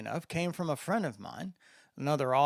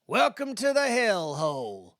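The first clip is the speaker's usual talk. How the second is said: louder and higher, proud Mississippi holler over the music; proud shout; 7 semitones higher, 7 dB louder than usual. A narrator's reading. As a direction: call out over a noisy bar, pleased with the place. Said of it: "Landon shouted proudly over the cacophony".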